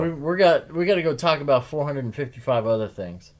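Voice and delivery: loud, high, mock-frantic sign-off; overplayed rush to leave; hammed-up excited.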